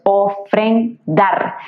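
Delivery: "ofrendar" slowly split as o, fren, dar; syllable by syllable. Shown as a demonstration. Teacher locating the accent for students, "ofrendar" in slow syllables. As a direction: separate each syllable of "ofrendar", landing on final dar. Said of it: "la fuerza de acentuación está en dar".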